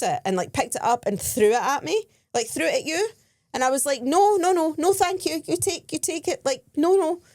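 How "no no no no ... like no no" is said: high, loud string of mock refusals; retold flustered refusal. Tourist giving the rose back, retold high insistence. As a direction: fend off a pushy seller, voice up.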